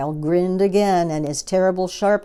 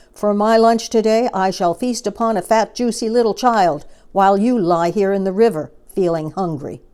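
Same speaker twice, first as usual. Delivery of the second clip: loud, higher triumphant boast; gloating croc; 3 semitones higher, 7 dB louder than usual. Acted. Enormous crocodile gloating at his hungry friend. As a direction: crow over your lunch plans.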